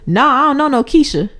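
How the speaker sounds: husband mishears grandma's thick New Orleans accent, confused mock reply; mishearing reply. Confused mock reply. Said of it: "And you said".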